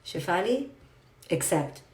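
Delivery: low, soft, steady reminder to herself; calm self-talk mantra. Shown as a demonstration. Teacher telling herself to accept, low and soft. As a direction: soothe yourself with the mantra. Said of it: "all I tell myself".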